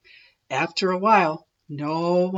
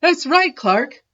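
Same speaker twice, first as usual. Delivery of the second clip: high, louder praise; approving; 8 semitones higher, 5 dB louder than usual. A narrator's reading. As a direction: cheer on Clark. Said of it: "said Missus Inkydink".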